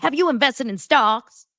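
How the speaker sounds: exaggerated scolding question; mock yell. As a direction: mimic someone yelling at a partner. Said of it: "like, yelled at Lance".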